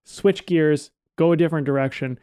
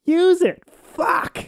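acting teacher's blunt order; much slower than usual. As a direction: bark it out like a fed-up acting teacher. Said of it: "She'd be like".

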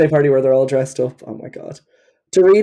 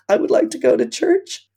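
mock tearful Rinna; 11 semitones higher than usual. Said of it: "and she's like".

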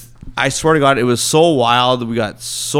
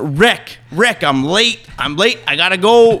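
higher-pitched urgent calling through a door; retold panic; 7 semitones higher, 3 dB louder than usual. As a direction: act out rousing a friend because you're late. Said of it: "I'm panicking 'cause my flight".

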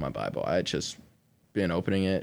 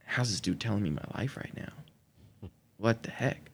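hushed amazement; 8 dB quieter than usual. Retold or imitated. Inner thought, amazed at text.

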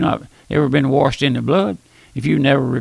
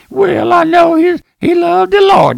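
much higher, slower, falsetto mimic of a sentimental mourner; sing-song falsetto parody of funeral praise; mock mourner; 8 dB louder, slower than usual. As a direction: ham up a pious, simpering mourner. Mocking a mourner's eulogy.

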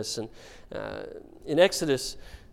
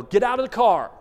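mock parent yell at kids in the car, louder; 7 semitones higher, 7 dB louder than usual. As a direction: bark it like a fed-up parent on a road trip. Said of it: "you pull over and you yell".

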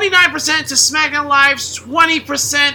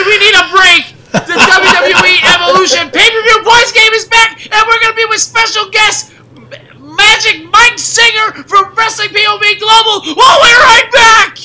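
rising hype, louder and higher; excited shout; 8 semitones higher, 8 dB louder than usual. Real, not acted. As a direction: hype the break like an announcer. Hyped sign-off before the break.

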